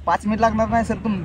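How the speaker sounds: usual speaking voice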